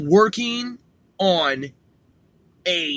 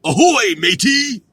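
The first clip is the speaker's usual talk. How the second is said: pirate hail; 7 semitones higher, 7 dB louder than usual. Pretend. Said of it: "what else does it say here".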